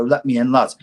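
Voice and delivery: lightly acted plazzy gangster at the door; pushy punter, half in jest; pretend gangster. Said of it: "Little gangsters, little pretend gangsters, plazzy gangsters".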